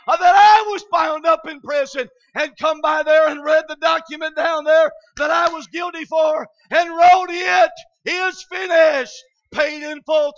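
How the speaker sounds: loud, rising, tearful shout; crying climax. Acted. Preacher crying out that his debt is paid, tearful loud shout. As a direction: cry it out at the peak of the sermon.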